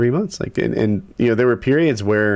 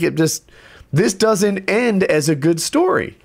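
heated rant; 9 semitones higher than usual. Retold or imitated. Homeowner refusing future deals with contractors, heated rant. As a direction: shut down the partnership hard. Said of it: "Don't do this rant on site".

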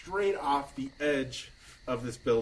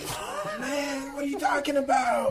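Mister Meeseeks; 14 semitones higher, 3 dB louder than usual. Acted. Popped-out helper introducing himself to the party with a loud, high cartoon shout. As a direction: burst out yelling like an eager cartoon helper.

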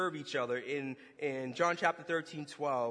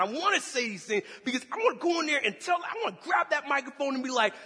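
loud, high, mounting tirade; indignant; 8 semitones higher, 12 dB louder than usual. Retold or imitated. Angry man refusing God's call, indignant shouting. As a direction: shout defiantly, refusing to calm down. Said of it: "I mean, I'm just indignant".

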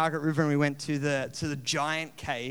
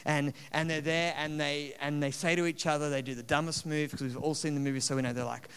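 ordinary talk

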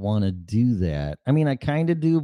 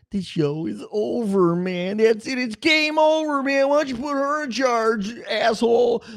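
panicked game over rant; 13 semitones higher than usual. Pretend. Host ends his show as a panicking soldier, high mock yell. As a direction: ham up a soldier losing it. Movie-style freakout signing off show.

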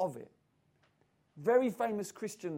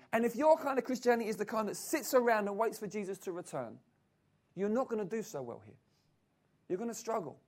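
ordinary talk, nothing marked

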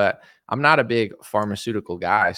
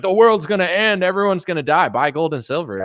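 higher-pitched mock doomsday sales pitch; doomsday gold salesman; 5 semitones higher, 3 dB louder than usual. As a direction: ham up a panicky gold seller. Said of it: "I couldn't be that guy that was like".

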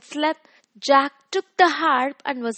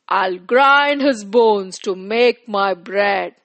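booming giant; 5 dB louder than usual. Acted. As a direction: boom it out like a giant. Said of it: "The giant cried".

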